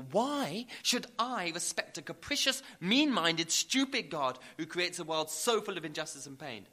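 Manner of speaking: loud, indignant tirade; Stephen Fry. Retold or imitated. Preacher quotes celebrity's reply to God, loud indignation. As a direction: build to forceful acted outrage at God. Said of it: "Stephen Fry's powerful and eloquent reply".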